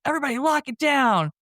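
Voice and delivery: high mock order, half-yelled; hammed-up panicky command; imitated yelling. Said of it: "So then he's still yelling".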